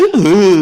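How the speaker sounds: high, loud choked sob sounds; whimpering sobs. Retold or imitated. Pastor mimics his wife's inner weeping, high choked sobs. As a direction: sob in short broken bursts. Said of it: "하면서".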